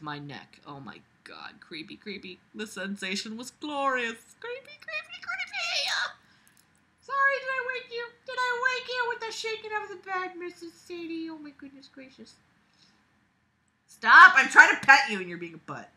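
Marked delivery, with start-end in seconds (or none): 4.4-12.3 very high, soft pet voice. Real, not acted. Talking to a pet. 13.9-15.9 loud, high, fast scold; squeaky exasperated scolding. Real, not acted. Scolding the pet.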